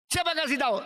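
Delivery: high, sharp question; startled demand. Acted. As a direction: demand to know who told. Brother startled that father knows.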